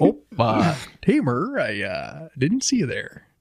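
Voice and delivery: mock Midwestern accent, first word drawn out. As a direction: slip into a folksy Midwestern accent. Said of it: "This is my Midwestern accent".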